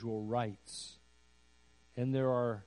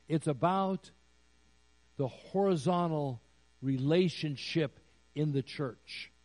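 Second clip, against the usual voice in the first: ordinary talk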